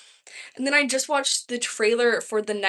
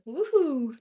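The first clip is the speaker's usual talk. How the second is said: mock cheer; 6 semitones higher than usual. Cheering her home state.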